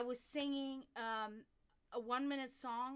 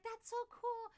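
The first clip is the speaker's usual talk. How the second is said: very high, impressed gush; pitched-up excited praise, retold; imitated admiration; 13 semitones higher, 4 dB louder, slower than usual. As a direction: mimic a woman thrilled for someone. Retells the lady's admiring reaction.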